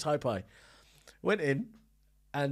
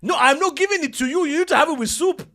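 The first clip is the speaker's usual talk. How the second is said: stern chef; 12 semitones higher, 11 dB louder than usual. Retold or imitated. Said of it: "He goes".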